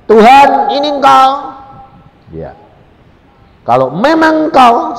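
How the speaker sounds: loud, high cry to God; calling out. Retold or imitated. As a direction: call out to God demanding proof. Crying out to God.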